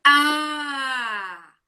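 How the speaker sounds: loud, long drawn-out A; strong open vowel. Shown as a demonstration. Teacher showing the strong vowel sound, loud and long. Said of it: "O som forte é esse aqui".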